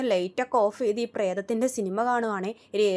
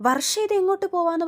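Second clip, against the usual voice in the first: alarmed question; 8 semitones higher, 7 dB louder than usual. Retold or imitated. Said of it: "Revathi ചോദിക്കും".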